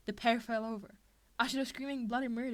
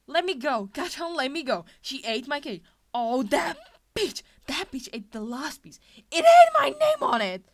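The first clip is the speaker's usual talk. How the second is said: furious screaming tantrum, much louder; 5 semitones higher, 16 dB louder than usual. A narrator's reading. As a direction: scream while kicking to break free. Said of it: "he screamed out".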